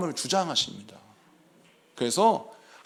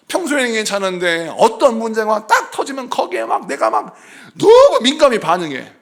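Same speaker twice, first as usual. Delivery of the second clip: usual speaking voice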